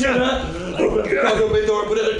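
loud, high action-movie bark; hammed-up blockbuster hero. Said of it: "starring Bruce Willis and Ben Affleck".

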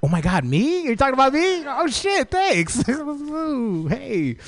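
very high, giddy mock delight; flattered gushing. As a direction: squeal coyly at the compliment. Acts flattered by man's compliment.

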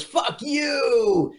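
high, drawn-out mock yell; retold defiant shout. Retelling the nurse finally answering back, mock defiant yell. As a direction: throw it out high and long, play-acting. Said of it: "she goes".

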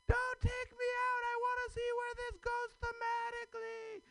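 very high manic squeals; manic squealing. Acted. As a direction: shriek with giddy glee. Comic's hyped manic crowd bit.

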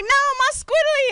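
very high squeaky tripping voice; squiddly dude voice. Retold or imitated. Friend tripping on ayahuasca, chanting in a very high squeaky voice.

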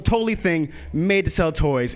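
usual speaking voice

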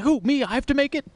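high mock-surprised voice; imitating Guy Fieri, high and startled. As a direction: squeak it out as a startled celebrity chef. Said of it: "Guy Fieri being like".

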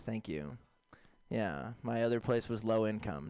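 normal talking voice

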